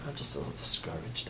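fast, whispered little confession; mock whisper. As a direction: breathe it out like a small sheepish admission. Mimicking someone sharing hurt.

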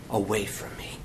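low, soft, whispered prayer; hushed plea. Retold or imitated. Jesus asking the Father for relief, whispered plea. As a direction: let it sink to a whisper.

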